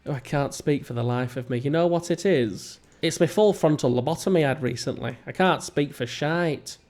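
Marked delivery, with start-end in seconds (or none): none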